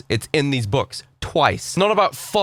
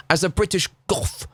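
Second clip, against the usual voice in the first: put-on self-important goth voice; a hammed-up haughty British goth; mock pompous British goth. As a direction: lampoon a smug British goth. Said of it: "forward and be like".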